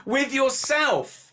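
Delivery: high, slow, drawn-out squeal; incredulous. Real, not acted. Host questions friend chatting alone, incredulous squeal. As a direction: stretch each word in high-pitched disbelief.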